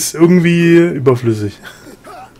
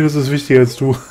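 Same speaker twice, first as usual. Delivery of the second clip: ordinary talk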